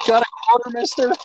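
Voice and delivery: high pleading panhandler voice; street beggar. Pretend. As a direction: beg for change in a high whine.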